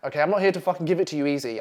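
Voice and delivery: usual speaking voice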